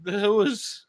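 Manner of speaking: "whoas" said high and drawn; mock pronunciation. Teasing the caller's word, with a high "whoas".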